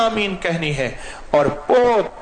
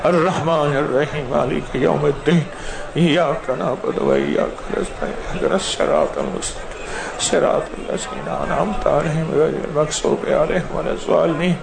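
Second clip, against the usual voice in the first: devotional recitation. Acted. Imam reciting Fatiha for the congregation, calm.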